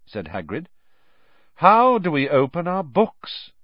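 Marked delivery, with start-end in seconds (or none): 1.5-3.5 higher, louder snide repeat; mock question. A narrator's reading. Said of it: "Malfoy repeated".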